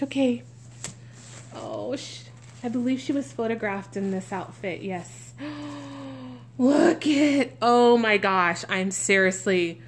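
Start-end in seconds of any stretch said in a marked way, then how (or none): 5.4-6.3 soft intake of breath in delight; real thrilled gasp. Real, not acted. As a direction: gasp quietly at a lovely surprise. Gasps on seeing the outfit.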